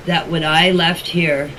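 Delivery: usual speaking voice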